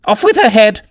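very high shrieked command; high, furious royal shriek; furious screaming. A narrator's reading. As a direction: scream it like an enraged queen. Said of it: "began screaming".

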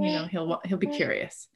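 ordinary talk, nothing marked

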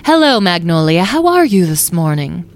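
loud, falsely cheerful greeting; mock sweetness. A narrator's reading. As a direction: taunt the prisoner with politeness. Wisteria mock-greets the prisoner.

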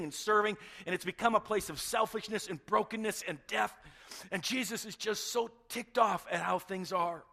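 loud, high, forceful sermon delivery; impassioned preaching. Real, not acted. Preacher telling congregation Jesus is angry at brokenness, impassioned and loud. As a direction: preach it at full force, building a list.

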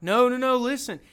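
urgent prophet calling out to the nation; pleading. Retold or imitated. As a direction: beg them to listen.

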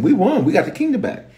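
high-pitched acted rejoicing. Men bringing news that they won, rejoicing. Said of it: "the men are rejoicing".

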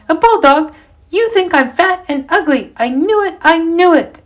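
high-pitched, offended wife; indignant outburst. A narrator's reading. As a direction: accuse your husband, deeply affronted. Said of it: "Missus Johnson blurted out".

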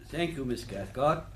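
ordinary delivery

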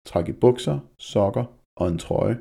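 ordinary delivery

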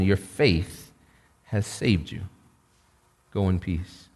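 soft, low and gentle; tender blessing. Retold or imitated. Jesus gently sends the forgiven woman away, soft and low. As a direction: send her off softly in peace.

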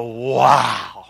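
low, drawn-out wow of amazement; awed wow. Acted. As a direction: act deeply impressed by a guest speaker. Acting awe at guest speaker.

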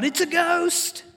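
high, slow cry of alarm; frightened cry. A narrator's reading. Disciples crying out at a figure on the water, frightened. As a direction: cry it out like terrified sailors. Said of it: "they cried out".

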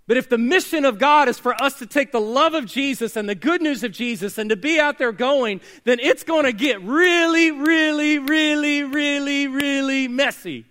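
crescendo, hammer every really; building preaching climax. Acted. Pastor rallying the congregation toward the mission, building to a big loud climax.

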